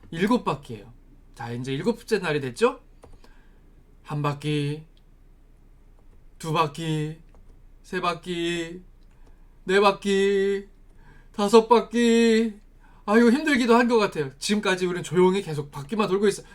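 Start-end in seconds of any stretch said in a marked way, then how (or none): 4.0-12.6 long, level chanted counting; slow lap count. Acted. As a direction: stretch each lap out, growing stronger. Counting laps with growing effort.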